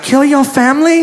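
high-pitched questioning caricature; imitated masseuse. Imagined masseuse whispering to agreeable client, high questioning caricature. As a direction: lilt it up as an innocent offer. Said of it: "She could have been like".

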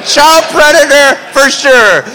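high, loud, slow mock terror; hammed-up panic as it dawns on him. Pinned down by a stranger, hammed-up panicked thought.

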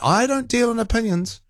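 a light high mock of the caller; mimicking John. Host mocking the caller again, mild high mimicry. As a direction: toss off his line in a mild mocking voice. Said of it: "he pretends he's not giving an opinion".

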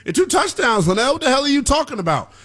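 high-pitched imitation of an arguing fan; mock protest in a high voice; mock objector. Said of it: "the box score is gonna tell you".

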